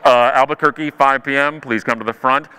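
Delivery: low, bored-sounding PA announcement; intercom announcer. Acted. Intercom calling late passengers to the front, low announcer voice. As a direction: drop low like an airport intercom call. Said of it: "the intercom comes on".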